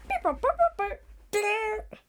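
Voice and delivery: high beeping and burping noises, playful; machine noises. Pretend. Kid making broken time machine noises. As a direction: mimic a sputtering machine, rising.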